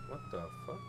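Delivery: ordinary delivery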